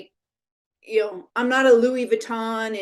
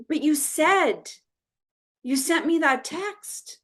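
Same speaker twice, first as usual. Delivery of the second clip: higher, slow tearful plea; 6 semitones higher, slower than usual. Retold or imitated. Crying friend explaining she got the text, mock tearful. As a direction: imitate a hurt, teary protest slowly. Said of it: "in tears".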